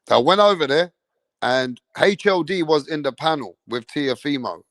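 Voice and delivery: ordinary talk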